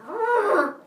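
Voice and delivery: loud, very high roar of rage. Retold or imitated. Bullied kid snapping at his tormentor, roaring. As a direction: let out a sudden furious roar. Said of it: "I just kinda went".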